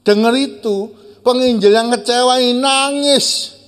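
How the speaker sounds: high, loud emotional telling; preacher swept up in the story; impassioned preaching. Acted. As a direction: build the drama of his weeping. Preacher narrating climax with rising intensity.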